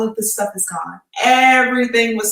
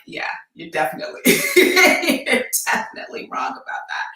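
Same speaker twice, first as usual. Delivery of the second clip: laughing; 7 semitones higher than usual. Retold or imitated. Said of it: "She's like".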